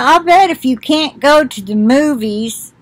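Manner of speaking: ordinary delivery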